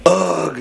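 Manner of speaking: high annoyed groan; bratty teenage ugh; irritated teen ugh. Shown as a demonstration. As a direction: groan like a fed-up thirteen-year-old. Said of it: "So he's like".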